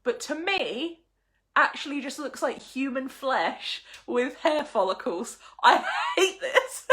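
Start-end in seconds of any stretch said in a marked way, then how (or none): 5.6-6.9 "I hate this" breaking into a laugh, much higher and louder; laughing disgust. Real, not acted. Collector laughs in disgust at the creepy flea card. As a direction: laugh it out, half revolted.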